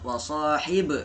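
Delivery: slow "wa sahib" with a short "e" popping after the b; long "sahi", echoed b in "wa sahib"; bouncing b. Shown as a demonstration. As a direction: recite "wa sahib", bouncing off the final b. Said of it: "Dia memantul".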